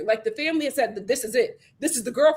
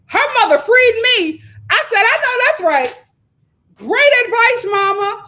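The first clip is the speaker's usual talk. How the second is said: much higher and louder, thrilled; excited cheering; 10 semitones higher, 8 dB louder than usual. Real, not acted. Host hyped by the mother's advice, loud high cheering.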